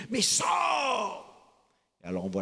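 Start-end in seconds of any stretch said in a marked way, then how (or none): 0.1-1.3 powerful voice. Acted. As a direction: order him out with all the power of God. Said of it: "la puissante voix de Dieu". (la voix puissante de Dieu qui crie, haut et fort)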